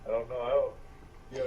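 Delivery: normal talking voice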